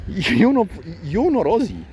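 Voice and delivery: high, loud accusation; indignant retort. Real, not acted. Man telling absent challengers they are not Aussie, indignant.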